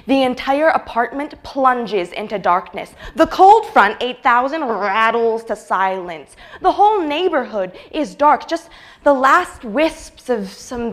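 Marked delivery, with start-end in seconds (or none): none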